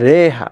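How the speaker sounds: রেহা with the vowel between a and i; correct pronunciation. Shown as a demonstration. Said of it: "জবর এবং জেরের মধ্যবর্তী স্থানে উচ্চারণ হবে".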